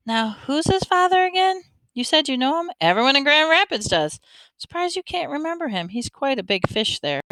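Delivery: high, inquisitive lady, gently prying; the woman's voice. A narrator's reading. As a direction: raise the pitch into a curious, prodding woman.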